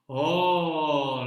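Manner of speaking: ordinary delivery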